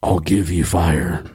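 deep Batman voice. Acted. Brooding Batman poem.

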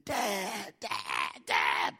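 drawn-out mock cries for Dad; imitated child's scream. Lost son calling for his father, imitated screaming. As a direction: call out like a lost, frightened boy, without full volume. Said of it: "I just hear him screaming".

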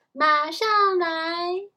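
loud, high, drawn-out shout; shouting back. A narrator's reading. Annie answers her mother out the window, shouting. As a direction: holler it back, stretching the words. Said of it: "她大叫".

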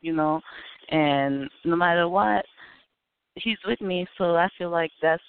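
ordinary delivery

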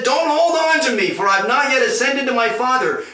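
high, loud warning; urgent command. Acted. Jesus to Mary clinging to him, urgent command.

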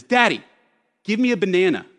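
put-on high, loud little girl's demand; demanding four-year-old. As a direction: bark it like a bossy toddler. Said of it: "the first thing she said was".

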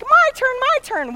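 high, loud imitation of eager kids; hammed-up kid demanding a go; clamoring kids. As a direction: mimic children yelling over each other. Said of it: "the kids are like".

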